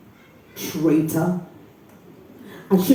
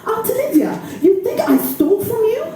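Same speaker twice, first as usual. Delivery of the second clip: much higher, disbelieving cry; shocked plea; 13 semitones higher than usual. Acted. Accused niece pleading with her aunt, shocked and high. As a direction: plead in alarm.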